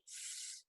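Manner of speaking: S as a sustained "sss" hiss; a voiceless "sss" for S; S sound. Shown as a demonstration. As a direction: make the sound of S, not the letter name. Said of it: "ele faz o som de".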